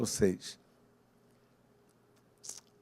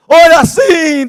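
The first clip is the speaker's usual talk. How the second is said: loud quoted command; 14 dB louder, much slower than usual. Retold or imitated. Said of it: "dizia ela".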